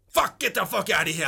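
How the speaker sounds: sudden loud exasperated swearing; angry outburst. Real, not acted. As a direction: blurt it out, fed up. Can't stand foot wiggling.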